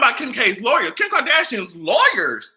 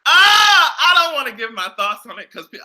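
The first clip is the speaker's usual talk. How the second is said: ordinary delivery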